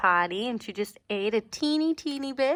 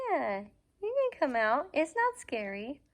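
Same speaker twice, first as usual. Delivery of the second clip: dog voice; 5 semitones higher than usual. Real, not acted. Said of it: "my dog voice".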